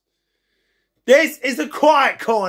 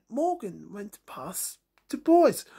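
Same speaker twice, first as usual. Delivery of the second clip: plain reading voice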